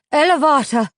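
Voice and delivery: high, loud incantation; commanding spell word. A narrator's reading. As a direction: cast the spell with force. Witch casting a spell.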